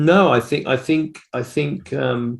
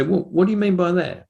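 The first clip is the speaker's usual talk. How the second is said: curious; 9 semitones higher than usual. Retold or imitated. Acting out an interested listener.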